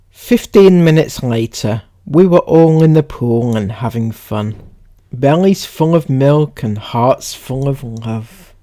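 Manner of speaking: measured, emphatic reading voice; read-aloud. Acted. Reading someone's post to viewers, read-aloud.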